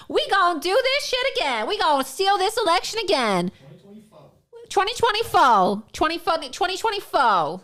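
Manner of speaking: hammed-up shouted parody of a campaign speech; mock rally shout. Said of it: "pretending to be".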